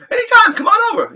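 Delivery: high, loud, eager invitation; mock high-pitched holler; straight-out blurt. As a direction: imitate a friend brashly piping up. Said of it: "he just says it straight out".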